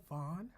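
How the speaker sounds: "Vaughn" said with a broad, open "ah" vowel; fancy surname pronunciation. Real, not acted. Host greeting Jerica by name, "Vaughn" with a fancy "ah". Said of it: "why are you saying her last name like that".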